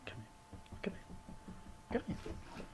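unvoiced, soft coaxing to the cat; whispered call to cat. Real, not acted. Calling cat quietly.

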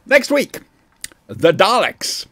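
dramatic announcer. Pretend. Dramatic episode teaser.

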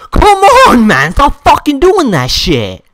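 very high, loud burst coming down; explode, then keep yelling the complaint; enraged yelling. Real, not acted. Player begging the spammer to stop, enraged yelling.

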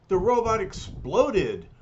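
high, loud exclamation; hollered. A narrator's reading. Khrushchev sees the robot blow up, hollering. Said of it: "hollers Khrushchev".

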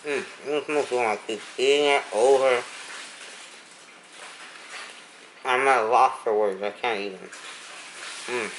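chewing while talking; muffled by food; mouth full. Real, not acted. Seen 1: took a bite at -4; cheeks puffed, chewing throughout the words.

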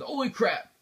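loud, high startled cry; startled shout. Real, not acted. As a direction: jump at a sudden attack. Skeletons attack suddenly.

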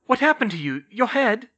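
high, urgent, worried questioning. A narrator's reading. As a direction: ask in motherly alarm. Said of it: "she said worriedly".

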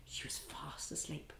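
hushed whisper, as if she's asleep; soft sleepy whisper. Acted. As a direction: whisper so you don't wake her. Storytelling to children, sleeping girl.

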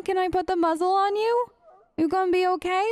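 normal talking voice

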